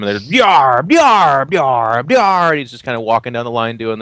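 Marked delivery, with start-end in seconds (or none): 0.4-2.5 loud, raised pirate greetings. Acted. Pirate crew greets the captain, loud and raised. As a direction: bark out each pirate R. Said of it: "saying R to each of them".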